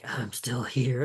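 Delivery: low, soft, slow sinister voice; evil voice. Retold or imitated. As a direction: murmur it like a lingering evil. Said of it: "them having the voice lingering".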